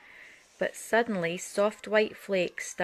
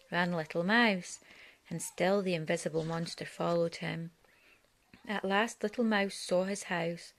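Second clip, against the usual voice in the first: ordinary delivery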